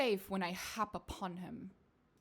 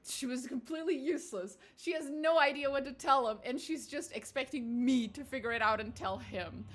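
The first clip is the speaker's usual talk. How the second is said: louder, higher exasperated venting; exasperated rant; 3 semitones higher, 6 dB louder than usual. Real, not acted. Player ranting to the table about the clueless scholar, exasperated. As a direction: rant in disbelief at the useless scholar.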